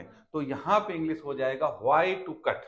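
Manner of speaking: ordinary talk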